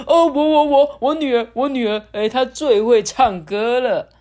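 high loud stammering boast; eager king. Acted. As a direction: jump in proudly for your daughter. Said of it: "国王就说".

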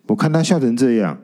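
ordinary delivery, nothing marked

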